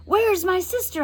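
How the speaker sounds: high, urgent yell. A narrator's reading. Josie yells at the witch for her sister. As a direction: shout it at the witch, scared. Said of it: "I yelled".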